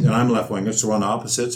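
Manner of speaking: ordinary talk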